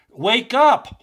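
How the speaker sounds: sudden loud, high shout. Real, not acted. Radio host jolting his listeners, shouting loud and high. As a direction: yell it to jolt them awake.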